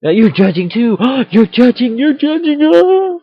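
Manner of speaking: rising hammed-up outrage ending in a squeal; mock accusation. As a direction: throw the accusation back with a gasp.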